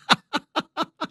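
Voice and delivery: high real laugh bursting out; burst of laughter. Real, not acted. Guest cracking up on live TV, real laughter. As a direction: laugh helplessly at the joke. Can't stop laughing on air.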